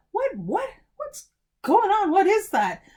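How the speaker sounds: high-pitched, startled questioning; bewildered. A narrator's reading. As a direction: blurt it out in baffled surprise. Boy splashed by leaking tree.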